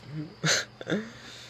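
soft, resigned little laugh; small laugh. Real, not acted. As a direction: laugh it off lightly. Laughs after resigned remark.

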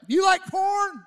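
high, loud call across the yard; calling out to the neighbour in his garage; shouted question. Retold or imitated. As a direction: shout it over the music.

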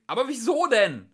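high, loud plea; dismayed yell; shouted protest. A narrator's reading. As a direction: demand a reason, crying out. Trillian protests to shouting police.

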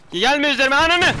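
climbing high, loud yell; frantic rising curse; panicked shout. Real, not acted. Yelling at approaching enemy.

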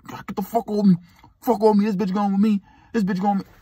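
high, strained yell of a pinned man; mock pained yelling. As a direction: yell like your wrist is twisted.

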